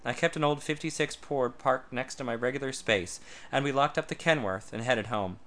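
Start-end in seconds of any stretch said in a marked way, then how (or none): none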